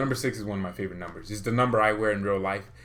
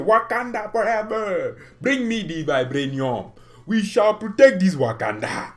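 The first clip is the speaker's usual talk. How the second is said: slow, loud put-on African accent; 8 semitones higher, 7 dB louder than usual. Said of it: "my African accent sucks".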